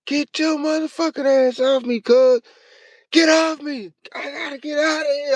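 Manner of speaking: high, panicked shouts to be let out; frantic yelling. Retold or imitated. Stuck at the bottom of the box, frantic yelling at cousin. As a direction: lose it, yelling at your cousin to get off. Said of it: "I lost my motherfucking mind".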